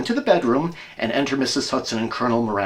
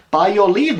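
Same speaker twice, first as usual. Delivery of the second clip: quoting the colonel; 10 semitones higher, 7 dB louder than usual. Retold or imitated. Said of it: "said he".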